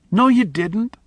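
put-on high, tearful child's retort; tearful boy. A narrator's reading. Boy Miles contradicting Charlie through tears. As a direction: cry it out like a hurt boy.

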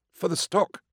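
high-pitched anxious farmer; meek, high character voice; nervous defendant. A narrator's reading. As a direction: offer the explanation in a high, timid voice.

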